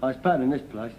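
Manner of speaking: ordinary delivery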